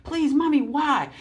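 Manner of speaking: put-on child begging mommy in a store; whining child. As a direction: plead like a child denied a toy. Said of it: "the kid's gonna say".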